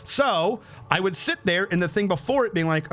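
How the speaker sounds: ordinary delivery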